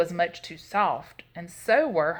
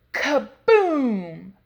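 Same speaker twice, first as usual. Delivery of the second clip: loud boom, falling from high; explosive crash word; 5 semitones higher, 7 dB louder than usual. A narrator's reading. Chair collapse sound effect.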